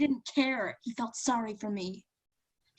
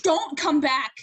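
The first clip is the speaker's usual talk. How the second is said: loud, higher shouted dismissal; angry shout; 4 semitones higher, 6 dB louder than usual. Acted. Woman banishing her ex for good, shouting angrily. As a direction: slam the door on him with your voice. Ordering him out.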